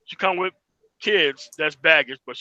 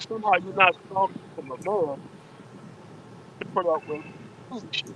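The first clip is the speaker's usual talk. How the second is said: garbled, watery bad connection; gargling underwater. Real, not acted. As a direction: voice breaking up underwater. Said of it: "sound like you're gargling underwater".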